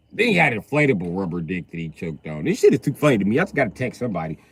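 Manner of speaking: normal talking voice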